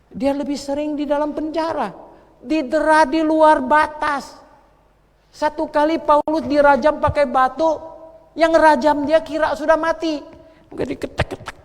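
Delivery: high, loud shouted sermon; thundering sermon against the devil; fiery preaching. Real, not acted. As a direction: preach it with full force to the congregation. Preaching at full force.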